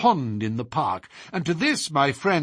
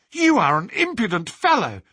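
high, loud outburst of outrage; indignant cry; 8 semitones higher, 5 dB louder than usual. A narrator's reading. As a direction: cry out in the maid's offended fury. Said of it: "cried Teresa".

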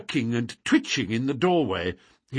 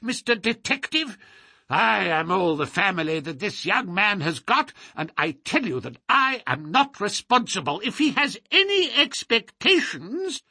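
put-on high, screechy, indignant old man; miserly old man's shrill tirade; querulous old lord, outraged; 8 semitones higher than usual. A narrator's reading. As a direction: rant in a high, crotchety old voice.

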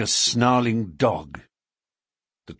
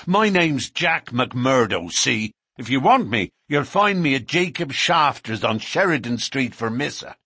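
defiant challenge; 6 semitones higher, 4 dB louder than usual. A narrator's reading. McMurdo challenges the police before the whole carriage, loud and defiant. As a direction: dare them to come for him.

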